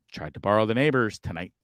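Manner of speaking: ordinary delivery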